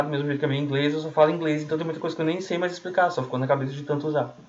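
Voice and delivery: ordinary delivery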